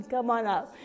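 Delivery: ordinary talk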